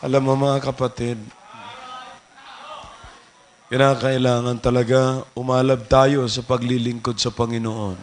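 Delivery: very low, hushed monotone; imitating soft-spoken preachers. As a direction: murmur the exhortation like a subdued preacher. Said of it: "Sasabihin ng iba".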